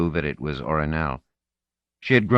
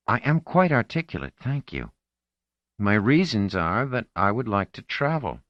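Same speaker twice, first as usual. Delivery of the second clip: put-on prim teenage girl; 6 semitones higher than usual. Marlena answers Orinel haughtily.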